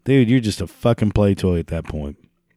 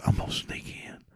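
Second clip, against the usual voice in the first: whispering; 13 dB quieter than usual. Acted. Acted redneck sneaking in.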